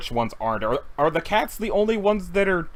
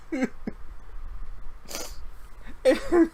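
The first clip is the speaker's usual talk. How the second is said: quiet wheezing giggle; breathless laugh; 9 semitones higher, 6 dB quieter than usual. Real, not acted. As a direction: laugh trailing off. Still laughing.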